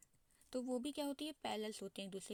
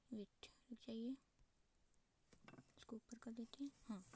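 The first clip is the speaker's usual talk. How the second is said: under the breath, much softer; hushed aside; 10 dB quieter than usual. Real, not acted. Teacher to self while moving the figure up, hushed aside. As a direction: drop to a near-whisper, as if to yourself.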